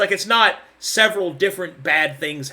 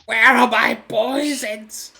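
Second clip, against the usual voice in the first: mock outrage; 5 semitones higher, 4 dB louder, much slower than usual. Joking about missing potions.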